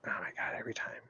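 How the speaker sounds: under-breath exasperated whisper; whispered aside. Real, not acted. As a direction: mutter it to yourself, fed up. Annoyed aside while checking sheet.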